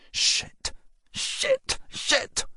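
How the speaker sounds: whispered, rising in panic on the middle one; breathless hushed panic; panicked whispered curses. A narrator's reading. Girl sees police lights coming, panicked whisper.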